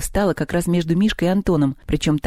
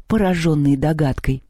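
ordinary delivery

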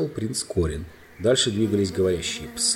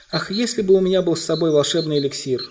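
higher-pitched wishful cry; exclaiming; 8 semitones higher, 3 dB louder than usual. A narrator's reading. Queen wishes she had the healing elixir, exclaiming. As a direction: exclaim with regret. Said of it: "воскликнула королева Люси".

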